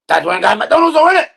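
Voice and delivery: loud, climbing to a high yell; mock outraged shout. Kid's family row over McDonald's, mock outraged shout. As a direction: build to a shrieked final word. Acts out aggrieved outburst.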